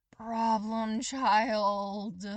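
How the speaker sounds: teacher groaning at his reckless student; groaned. Acted. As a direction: groan the words out in exasperation. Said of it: "Aizawa groaned into his hands".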